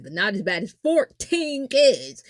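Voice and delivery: usual speaking voice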